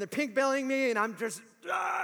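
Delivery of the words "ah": a retold howl from a kid being roughed up; pained yell. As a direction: cry out while being pinned. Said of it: "I'm yelling at 'em".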